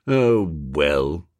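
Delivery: low, sighing surrender; resigned. A narrator's reading. Freddy giving in to his pal, resigned. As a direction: give in, voice dropped low. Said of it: "in a resigned sort of voice".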